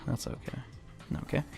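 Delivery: breathy whisper, under his breath; whispered to himself. Real, not acted. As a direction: whisper it to reassure yourself.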